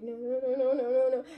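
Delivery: building anguished no's; distressed no's. Retold or imitated. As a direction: protest in growing despair. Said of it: "I got, like, so emotional".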